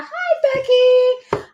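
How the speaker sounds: 2 a high, drawn-out squeal of hello; 3 light up and sing out a hello to a friend; 1 squealed greeting. Real, not acted. 4 host spots her friend Becky in the live chat, squealing hello.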